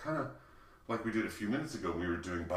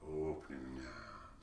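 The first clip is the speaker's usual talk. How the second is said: ordinary delivery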